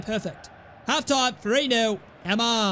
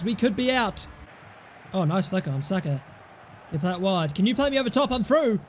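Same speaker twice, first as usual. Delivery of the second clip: ordinary delivery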